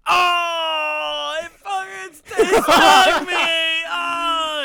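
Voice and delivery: high, loud, drawn-out wail; imitated crying scream. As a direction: wail like a just-stung little brother. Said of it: "And he starts crying".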